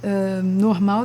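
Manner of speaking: normal talking voice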